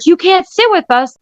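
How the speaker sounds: high-pitched shouted brush-off; retold shout in church. Woman retelling how she shouted at her stalker in church, high mock shout. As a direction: yell it out across the pews. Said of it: "here I am shouting in church".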